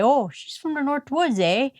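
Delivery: put-on North Woods Yooper accent. Shown as a demonstration. Speaker shows her North Woods talk, Yooper accent. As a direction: exaggerate the Yooper accent, starting high. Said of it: "I talk like a Yooper".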